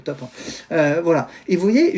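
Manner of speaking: normal talking voice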